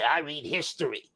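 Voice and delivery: mimicking a smug history reader; mocking voice. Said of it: "We don't wanna hear a damn thing".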